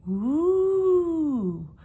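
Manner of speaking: high, loud ghostly howl; spooky howl. Acted. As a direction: howl like a Halloween monster. Spooky howling in the story.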